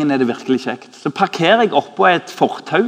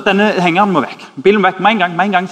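sharp, louder, higher retold order to move the car; irritable demand; 5 semitones higher, 7 dB louder, faster than usual. Annoyed man demanding the trailer be moved, loud and curt. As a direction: bark it like an annoyed car owner. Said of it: "Så var han litt sånn amper".

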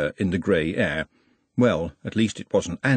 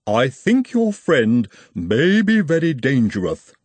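measured, formal Igor voice with a foreign lilt; slow, polite and ominous Igor; 4 semitones higher, 4 dB louder, slower than usual. Acted. Igor warns Trev.

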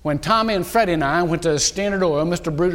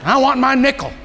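God demanding; 7 semitones higher than usual. Retold or imitated. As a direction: call it out like God in a nightmare. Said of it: "I dreamed God came to me and said".

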